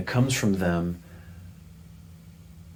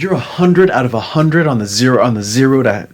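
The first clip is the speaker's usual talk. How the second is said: louder, higher, amazed question; husband marvelling at his wife's answer, excited; delighted disbelief; 6 semitones higher, 8 dB louder than usual. Retold or imitated. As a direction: marvel at her answer, pitched up.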